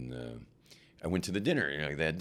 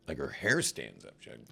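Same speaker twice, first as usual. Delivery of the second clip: ordinary delivery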